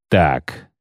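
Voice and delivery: low, slightly slow villain; villain's remark. A narrator's reading. Bounty hunter greets the newcomers, low and unimpressed. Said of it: "сказал он".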